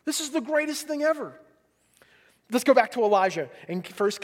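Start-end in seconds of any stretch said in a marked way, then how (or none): 0.0-1.4 high-pitched, elated proclamation; raised voice, stretching out the good news; telling everyone. Acted. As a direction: announce joyful news to anyone who will hear. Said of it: "we wanna tell everyone".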